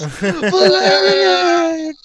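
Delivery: high, slow, shouted chant of the name; crying out his name. Acted. Grateful survivor chants the paladin's name in a slow shout. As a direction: wail the hero's name in joy.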